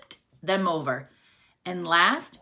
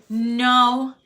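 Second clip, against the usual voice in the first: usual speaking voice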